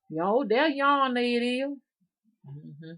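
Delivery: ordinary talk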